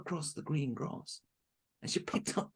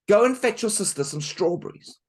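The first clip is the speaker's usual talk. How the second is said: loud, higher bossy order; bossy stepmother; 7 semitones higher, 11 dB louder than usual. Acted. Stepmother ordering Marushka out, loud and bossy. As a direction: order her about.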